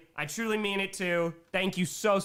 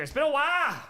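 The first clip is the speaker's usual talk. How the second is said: higher-pitched, playful burst; high playful exclamation; 6 semitones higher, 3 dB louder, faster than usual. Pretend. Streamer opening a first beer, high and playful. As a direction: pitch it up and let it drop at the end. Riffing on a familiar phrase.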